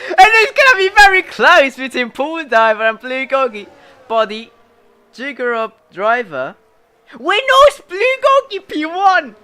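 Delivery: high, loud race commentator; excited shout. Real, not acted. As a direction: shout the near miss. Close pit battle called.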